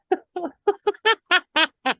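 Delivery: high laugh. Real, not acted. Laughing at own story.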